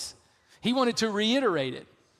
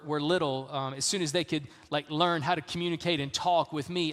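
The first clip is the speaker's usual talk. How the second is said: ordinary talk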